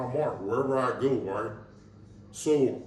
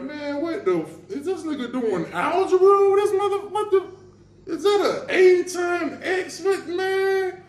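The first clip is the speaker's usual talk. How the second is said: high, loud disbelieving rant; mock outrage at the numbers; half-laughing high-pitched disbelief; incredulous; 5 dB louder than usual. Inner reaction to lawyer's math.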